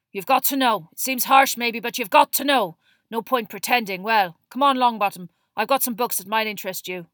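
loud, gruff, insistent voice; gruff teacher Moody. A narrator's reading. Moody steers Neville away, loud and gruffly insistent. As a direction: press the point, then chivvy him along.

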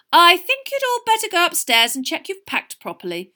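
high, loud motherly intervention; breaking up argument. A narrator's reading. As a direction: cut firmly across a quarrel. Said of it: "breaking up the argument".

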